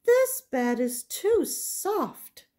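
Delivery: put-on high, slow, breathy little girl; Goldilocks. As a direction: squeak it out like a small girl fussing over a bed. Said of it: "and said".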